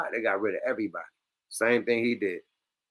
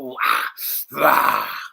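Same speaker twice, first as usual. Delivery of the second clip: mock grunting; 3 dB louder, slower than usual.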